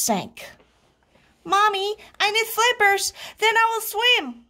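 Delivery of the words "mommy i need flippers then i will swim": put-on very high child voice; pleading little frog. A narrator's reading. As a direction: whine up high to mommy for gear.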